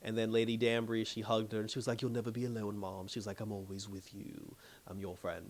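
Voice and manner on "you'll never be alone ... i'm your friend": much softer, low, hushed comfort; tender reassurance. Retold or imitated. Lady Danbury comforts the queen in a hug, hushed and tender. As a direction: soothe her as you hold her.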